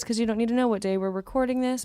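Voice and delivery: ordinary talk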